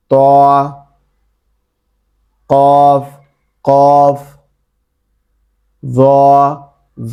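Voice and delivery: each of খ, সদ, দদ, গইন, ত, কফ, য held long and heavy; sustained level-pitched heavy Arabic letters, খ through য; thick letter drill. Shown as a demonstration. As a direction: sound every letter twice, full and thick. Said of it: "এভাবে আমরা যদি বারবার উচ্চারণ".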